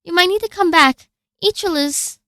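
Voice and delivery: loud urgent high call; calling out loudly. Acted. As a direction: shout it across the hall.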